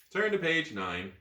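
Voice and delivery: ordinary delivery, nothing marked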